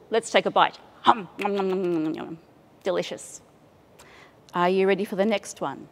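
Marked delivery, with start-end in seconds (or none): 1.1-2.4 mock munching. Pretends eating apple.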